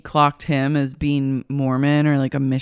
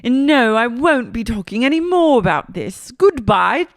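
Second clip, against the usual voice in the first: high, mock British dismissal; [name]'s British accent; 8 semitones higher than usual. [name] ending talk of gossip, mock British accent. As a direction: sign off in hammed-up British. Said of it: "goes back into a British accent".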